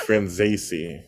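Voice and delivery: "Franzese" with a long A in the middle, fran-ZAY-see; correct name pronunciation. Shown as a demonstration. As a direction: stretch the "zay" in "Franzese", said slowly. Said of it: "Zay, it's a A".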